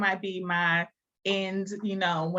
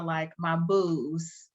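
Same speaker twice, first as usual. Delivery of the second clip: ordinary talk, nothing marked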